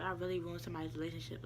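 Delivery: soft, quick mutter to oneself; inner thought. Retold or imitated. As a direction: say it low and fast, as if only thinking it. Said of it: "I was saying that to myself in my head".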